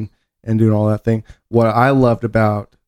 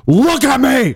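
mock commanding shout; slower than usual. Ordering John to stop hiccuping, hammed-up shout. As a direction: bark it like a drill sergeant.

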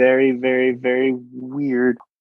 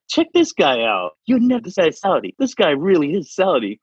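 higher, hyped-up influencer voice; lightly voiced excited influencer; 7 semitones higher than usual. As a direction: hype the clip to followers. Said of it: "He's like".

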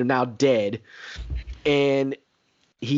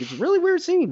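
baffled retelling; 14 semitones higher, faster than usual. Retold or imitated. Retold reaction, I was just like.

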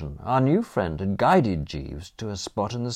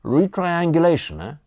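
higher-pitched pondering; musing; 10 semitones higher, 5 dB louder than usual. A narrator's reading. Thin don considering the survey story, musing. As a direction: turn the word over with interest. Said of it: "the thin man mused".

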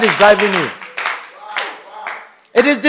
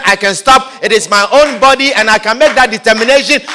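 ordinary delivery

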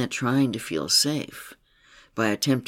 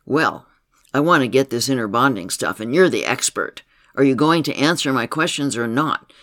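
pushy and demanding, louder, quick on the middle; challenging tone; 7 dB louder than usual. Retold or imitated. Client challenging her therapist for answers, demanding and loud. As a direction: press for answers, impatient. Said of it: "with the same challenging tone".

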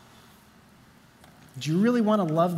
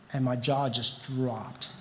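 stunned quiet; 7 semitones lower, 7 dB quieter, much slower than usual. Real, not acted. Stunned moment in the story.

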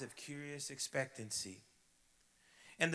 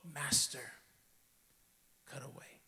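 voiceless whispered surrender; soft whispered yielding; low whisper; 4 dB quieter than usual. A narrator's reading. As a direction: barely breathe "cut away". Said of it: "whispering low".

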